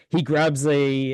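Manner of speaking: normal talking voice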